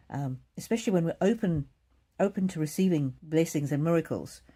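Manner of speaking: usual speaking voice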